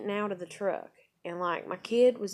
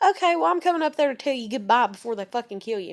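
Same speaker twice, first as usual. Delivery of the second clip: loud, fast, higher retold comeback; sarcastic defiance; 5 semitones higher, 10 dB louder, faster than usual. Girlfriend announcing a goodbye visit to boyfriend, sarcastic. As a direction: re-enact calling his bluff at full volume.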